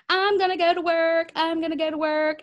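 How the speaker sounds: high, loud, eager self-talk; mock eager. Coach acting out her eager self before work. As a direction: pump yourself up to work.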